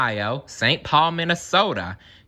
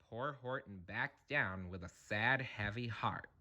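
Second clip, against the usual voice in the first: very soft telling; 2 semitones lower, 18 dB quieter than usual. A narrator's reading. Hushed after the shout.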